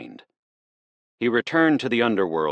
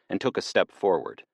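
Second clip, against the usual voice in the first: usual speaking voice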